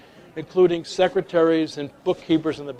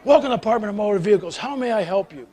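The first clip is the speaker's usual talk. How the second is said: very fast, a little higher, recited; brisk clerk rushing through his welcome; rattled-off greeting; 4 semitones higher, faster than usual. Retold or imitated. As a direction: fire off the stock welcome.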